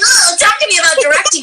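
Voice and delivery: eager request. Acted. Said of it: "I'm like".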